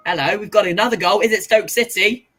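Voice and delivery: loud fast goal alert; excited loud announcement. Real, not acted. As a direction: burst in with the news.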